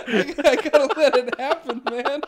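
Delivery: loud laughing shout. Real, not acted. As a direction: laugh through it. Laughing catharsis.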